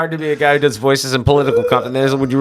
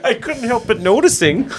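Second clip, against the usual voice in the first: giraffe character; 9 semitones higher than usual. Acted.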